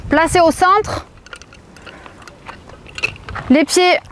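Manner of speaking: usual speaking voice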